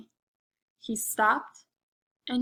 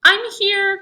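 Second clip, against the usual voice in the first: much higher, louder call; mama calling out; 9 semitones higher, 11 dB louder than usual. Acted. Big Mama Mummy answering her son, loud and high. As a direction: call out to reassure the little one. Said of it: "cried Big Mama Mummy".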